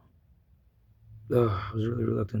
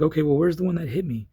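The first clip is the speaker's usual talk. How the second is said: higher and louder, insistent; exasperated demand; 6 semitones higher, 5 dB louder than usual. Retold or imitated. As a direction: want an answer now. Demanding answer from officer.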